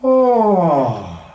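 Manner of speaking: high, loud sigh sliding down like a yawn. Shown as a demonstration. Voice teacher leading a yawn exercise, loud falling sigh. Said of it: "start with ah and yawn it out".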